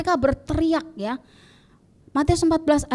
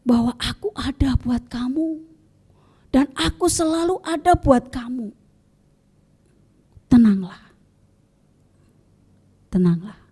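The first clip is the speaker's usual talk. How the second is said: gentle. A narrator's reading. Jesus calming his frightened disciples, gentle and hushed. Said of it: "dengan lembut".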